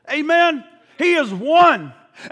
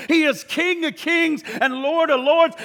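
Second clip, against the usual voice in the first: ordinary talk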